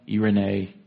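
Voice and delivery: "Irene" said the Greek way, ee-reh-NAY; "Irene" in three syllables, ending in nay; Greek pronunciation. Shown as a demonstration. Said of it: "it's pronounced differently".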